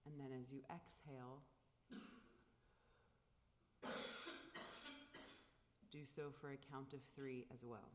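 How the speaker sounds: calm, unhurried guiding of the breath; guided meditation. Acted. Leads a silent meditation.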